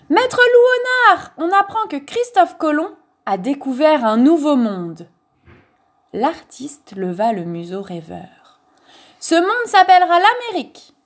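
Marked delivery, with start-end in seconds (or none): 0.1-5.1 high, loud cry bursting in; excited shout. A narrator's reading. Someone bursts in with news for the master, shouting. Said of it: "ouvrit la porte en criant". 9.2-10.9 high, loud declaration; grand proclamation. A narrator's reading. Dreamy old painter naming a new world, proclaiming loudly.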